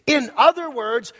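ringing, insistent pulpit delivery; emphatic preaching. Real, not acted. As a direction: proclaim it with punched key words. Preaches at full force.

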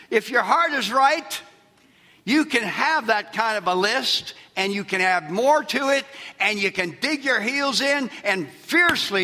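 high, loud rousing exhortation; full-force preaching. Acted. Pastor urging believers to defend God's word, preaching loudly. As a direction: lift them up with fire.

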